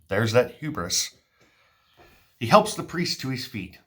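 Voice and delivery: ordinary talk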